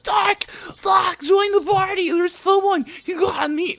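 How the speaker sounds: very high, loud imitation squeal; fanboy excitement. Fanboy friend urging him into the party, mock excited shout.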